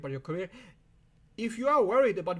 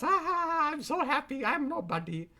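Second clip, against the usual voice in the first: a lightly voiced blissful guru, high at first; airy mock-blissful sigh of a guru, then back to own voice; Ram Dass imitation; 9 semitones higher than usual. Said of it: "Like Ram Dass".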